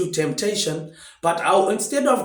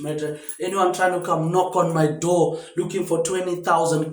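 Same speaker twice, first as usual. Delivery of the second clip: ordinary talk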